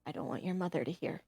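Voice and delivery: hushed, quick and soft; low voice. Acted. Father at the barely open door to his daughter, low voice. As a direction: keep it down so no one overhears. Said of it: "he'll say in a low voice".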